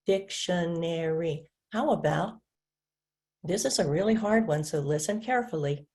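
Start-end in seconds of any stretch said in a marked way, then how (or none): 0.1-1.5 "Dictionary" slowly split, dic-tion-ar-y; syllable by syllable. Shown as a demonstration. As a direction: count out four even beats in "Dictionary". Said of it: "Let's clap it".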